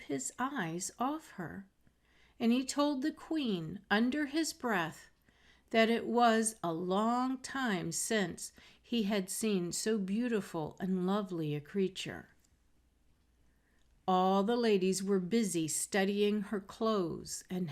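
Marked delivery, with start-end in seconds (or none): none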